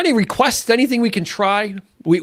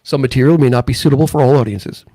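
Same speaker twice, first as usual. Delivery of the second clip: movie trailer voice; 5 semitones lower than usual. Shown as a demonstration. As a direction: boom it like a trailer. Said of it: "in a world".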